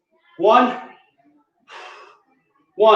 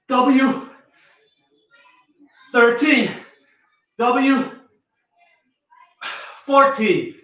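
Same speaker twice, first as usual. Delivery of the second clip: ordinary talk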